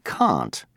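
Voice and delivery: can't with a long open ah, kahnt, said slowly; British can't. Shown as a demonstration. Teacher contrasting for students, British broad-a in can't. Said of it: "the British say".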